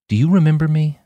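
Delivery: hope against hope he knows her; hopeful Topaz. A narrator's reading. Topaz asks the man she loved if he remembers her, hopeful. Topaz pleading, voiced by narrator.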